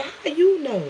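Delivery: high, astonished retort; startled question. Retold or imitated. Sister reacting when her source is guessed, startled.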